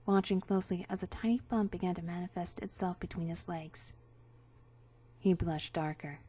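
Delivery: ordinary delivery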